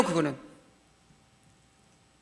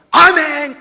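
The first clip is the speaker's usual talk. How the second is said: shouted amen, higher; loud amen; 6 semitones higher, 9 dB louder, much slower than usual. Retold or imitated. Choir member shouting amen in church, loud. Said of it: "크게 했어요".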